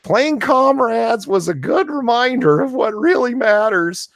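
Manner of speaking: high-pitched put-on activist voice; mock an earnest larper in a squeaky high pitch; neat larper voice. Host mocking an article he can't read normally, high larper voice. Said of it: "the neat larper voice".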